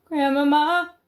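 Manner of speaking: high loud cry of Grandmama; shouted call. Acted. Frightened boy shouting down to his grandmother, high loud call. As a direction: yell Grandmama up high to reach below. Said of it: "I shouted again".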